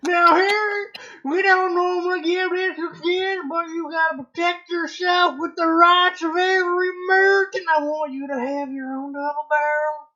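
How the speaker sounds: high, loud hillbilly Hagrid. Acted. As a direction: counsel the boy in a hammed-up backwoods accent. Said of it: "I said hillbilly, not Texan".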